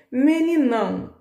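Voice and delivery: Meninão drawn out high and slow; teacher's question word. Shown as a demonstration. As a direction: lift Meninão high, slow, asking the class. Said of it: "tá no aumentativo?".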